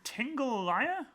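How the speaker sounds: "Tingle Liar" drawn out as a question; tentative, slow reading of "Tingle Liar"; unsure pronunciation. Real, not acted. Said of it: "how do you say that?".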